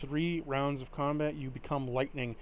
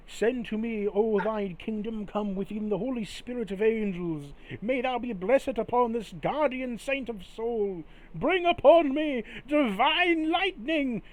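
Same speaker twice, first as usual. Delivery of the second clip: rising incantation; 7 semitones higher, 4 dB louder than usual. Acted. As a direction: summon divine power, swelling to the climax. Father calls down divine lightning.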